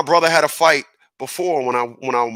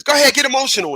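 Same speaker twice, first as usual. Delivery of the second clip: high, insistent goading; egging on; 11 semitones higher, much faster than usual. Acted. Mad goading emotion on, high egging voice. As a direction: pitch it up and urge them on. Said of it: "mad says".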